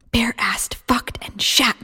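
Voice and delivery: whispered cursing under the breath; voiceless muttered swearing; silent curses. A narrator's reading. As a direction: hiss the curses without voice. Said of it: "came the silent curses now".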